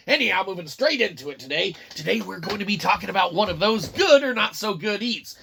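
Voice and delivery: ordinary talk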